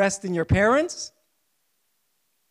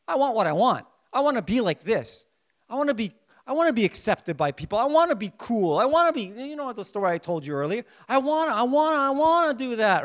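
a hint of a whiny, self-centred inner voice; selfish flesh; 6 semitones higher, 5 dB louder, faster than usual. Preacher voicing the sinful flesh, mildly whiny. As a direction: lightly voice the flesh demanding its own way. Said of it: "That's the flesh".

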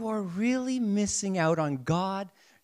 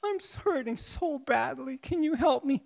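put-on higher, pleading child voice; hurting child; 8 semitones higher than usual. Preacher voicing a hurting child to their father, higher and pleading. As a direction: plead like a hurt little kid asking Dad. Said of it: "If your child came up to you and said".

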